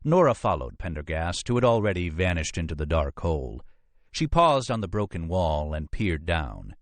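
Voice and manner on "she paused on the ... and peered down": ordinary delivery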